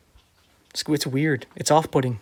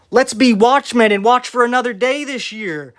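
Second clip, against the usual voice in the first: higher and louder, mocking his own words with exaggerated cheer; sarcastic mock quote; 7 semitones higher, 4 dB louder than usual. Vlogger mocking the commenter's view of his words, sarcastic sing-song. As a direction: parrot your own advice back in a sing-song, sarcastic lilt. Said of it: "by saying".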